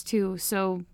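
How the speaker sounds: ordinary talk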